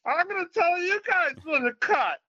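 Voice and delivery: put-on drunk order. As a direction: bark cues at the crew while smashed. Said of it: "starting to tell them".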